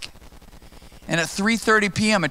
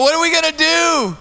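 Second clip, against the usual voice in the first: panicked question; 11 semitones higher, 4 dB louder than usual. Retold or imitated. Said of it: "I go".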